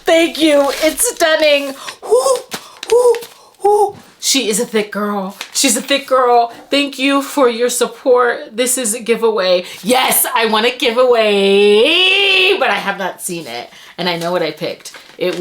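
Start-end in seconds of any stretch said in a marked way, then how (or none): 2.0-4.0 short high woos; excited woos. Real, not acted. Vlogger overwhelmed by her book gift, excited woos. 9.8-12.6 drawn-out rising squeal; excited squeal. Real, not acted. Vlogger thrilled about her giveaway, squealing.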